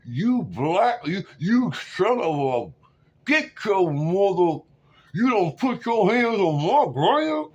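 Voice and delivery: loud, high, slow furious imitation; hammed-up angry grandmother, words dragged out; mad grandma, mouth sideways. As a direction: rant out of the side of your mouth. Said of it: "She moved her mouth to the side like this".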